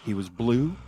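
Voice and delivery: plain reading voice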